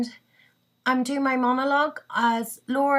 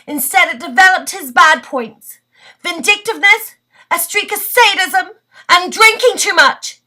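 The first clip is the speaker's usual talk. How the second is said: raised, emphatic listing, pressure mounting; acted bitter outburst; 5 semitones higher, 9 dB louder than usual. Laura lists her husband's bad points, acted bitterness building. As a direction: list his faults louder and higher, building.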